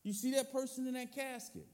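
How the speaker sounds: high, pressing preacher voice; urgent preaching. Acted. Pastor warning the church that everyone dies, urgent. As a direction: drive the point home, pitched high.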